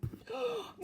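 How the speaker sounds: high startled gasp; bear's gasp. Acted. Bear spotting its lost teddy, sharp gasp. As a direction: gasp in shock. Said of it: "gasped the bear".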